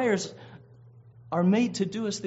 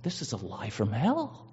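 ordinary talk, nothing marked

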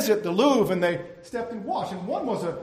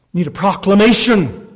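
rising to a shout; 11 dB louder than usual. Real, not acted. Preacher urging the congregation after a list of needs, loud climax. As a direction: punch it out to the back row.

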